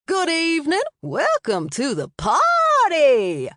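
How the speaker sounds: high, loud, stretched-out shriek into the mic; shrieking announcer. A narrator's reading. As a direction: greet the crowd with a piercing slow shriek. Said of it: "Blue Ethel shrieked from the microphone".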